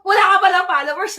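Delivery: loud mock jab about no followers; loud teasing exclaim. Repeated after laughing, much louder.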